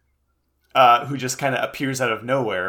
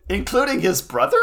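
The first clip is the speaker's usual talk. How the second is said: incredulous; 11 semitones higher than usual. Real, not acted. Incredulous question.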